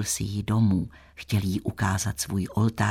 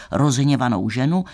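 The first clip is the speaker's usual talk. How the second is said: usual speaking voice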